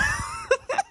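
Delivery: very high wheezy laugh; wheezing laugh. Real, not acted. Overcome by the sister dance story, wheezing laugh. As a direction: laugh until it turns into a wheeze. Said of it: "Sorry for the wheezing into the laugh".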